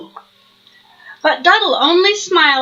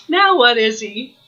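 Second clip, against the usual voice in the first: usual speaking voice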